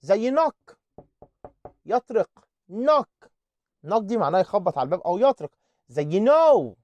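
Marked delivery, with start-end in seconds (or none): 2.7-3.1 "نوك" starting straight on N; higher, clear model "نوك" with no opening K; silent K. Shown as a demonstration. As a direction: say knock as "نوك", first K dropped. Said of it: "ال كي إن بتنطق إن".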